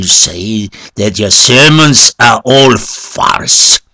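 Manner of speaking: high, forceful shout; shouted warning. A narrator's reading. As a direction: shout a threat at the priest. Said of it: "opened his eyes and shouted".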